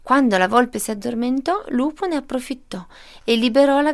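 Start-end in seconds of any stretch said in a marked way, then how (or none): none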